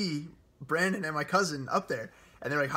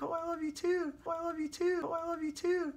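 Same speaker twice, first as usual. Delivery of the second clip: imitating girlfriend. Imitates girlfriend's gushing reply, thrice.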